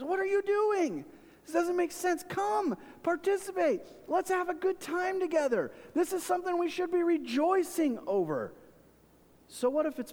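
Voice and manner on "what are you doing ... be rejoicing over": put-on parent pleading with kids. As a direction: coax the kids to join the fun, pitched way up. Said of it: "I do this with my own kids sometimes".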